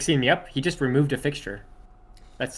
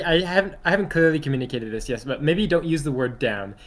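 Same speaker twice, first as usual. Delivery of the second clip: normal talking voice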